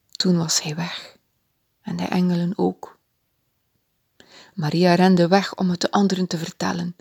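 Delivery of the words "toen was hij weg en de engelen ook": ordinary delivery, nothing marked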